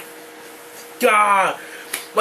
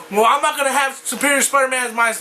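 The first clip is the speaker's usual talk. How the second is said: normal talking voice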